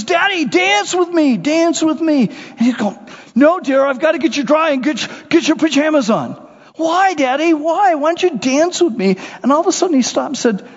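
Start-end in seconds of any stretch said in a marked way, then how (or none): 0.0-2.3 put-on little girl. Little girl voiced in story. 3.4-6.3 busy dad. Retold or imitated. Dad voiced in story. 6.7-9.2 put-on little girl. Little girl voiced in story.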